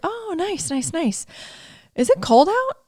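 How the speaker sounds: high, bright small talk voice; mimicking the clerk. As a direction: put on the clerk's breezy chatter. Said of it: "And he's like".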